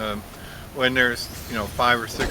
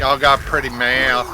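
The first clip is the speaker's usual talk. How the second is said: appalachian hillbilly, slow and loud; loud slow appalachian accent; 3 semitones higher, 11 dB louder, slower than usual. Pretend. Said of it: "like a hillbilly".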